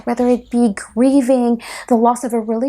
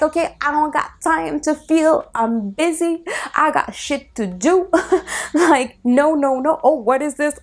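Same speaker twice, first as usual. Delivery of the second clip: higher hurried dismissive put-on voice; mock busy self; 6 semitones higher than usual. Mocking how people avoid their feelings, in a higher put-on voice. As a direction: play a frantic person brushing feelings off. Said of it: "We're like".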